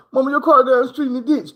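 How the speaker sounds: put-on high, anxious kid telling mom; high girl voice. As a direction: sister breaks the news to mom. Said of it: "She was like".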